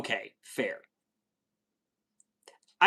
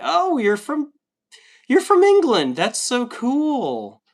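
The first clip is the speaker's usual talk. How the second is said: high, loud, gushing delight; thrilled fan gushing; excited; 10 semitones higher, 4 dB louder, slower than usual. Real, not acted. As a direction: squeal with excitement at the news. Excited at UK viewer.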